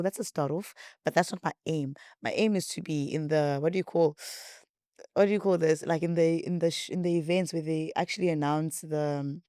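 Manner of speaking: normal talking voice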